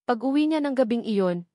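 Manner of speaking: ordinary delivery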